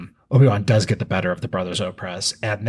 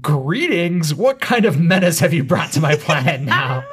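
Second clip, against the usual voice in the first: Hondo voice; 6 semitones higher than usual. Retold or imitated. Pirate captain Hondo answering Obi-Wan, incredulous. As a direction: throw the greeting back in disbelief. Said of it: "And Hondo says".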